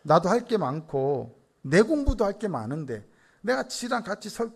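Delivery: ordinary delivery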